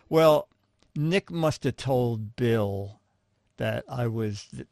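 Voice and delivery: ordinary talk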